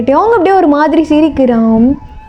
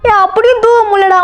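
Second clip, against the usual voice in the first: higher-pitched fretful complaint; panicked friend; 6 semitones higher than usual. Acted. Friend telling his pals he is stuck, panicked. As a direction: fret in a raised pitch, mildly panicked.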